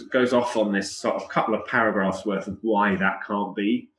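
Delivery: ordinary delivery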